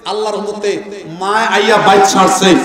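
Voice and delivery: ordinary delivery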